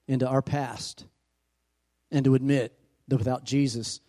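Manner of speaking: usual speaking voice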